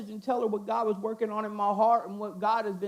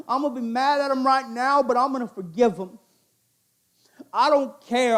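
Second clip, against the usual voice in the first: preaching at full force; 5 dB louder than usual. Acted.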